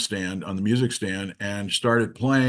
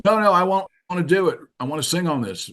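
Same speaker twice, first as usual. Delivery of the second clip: put-on higher, insistent British accent; pitched-up British voice, easing down; 8 semitones higher, faster than usual. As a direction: imitate a keen British singer insisting. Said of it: "with a British accent".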